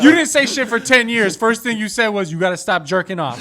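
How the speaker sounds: loud, indignant mock rant; mock outrage. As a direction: tell off the cat, building to a laughing shout. Mock quarrel with the talking cat.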